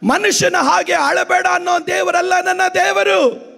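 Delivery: high, impassioned sermon cry; full-force preaching. Real, not acted. Pastor telling the weeping that God will act, full-force preaching. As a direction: proclaim it to the congregation with fervour.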